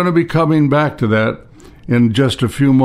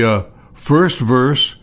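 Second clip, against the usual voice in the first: ordinary talk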